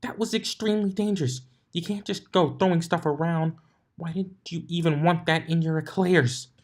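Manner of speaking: high, exasperated scolding. A narrator's reading. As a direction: scold her like a worried teacher. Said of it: "Twilight scolding".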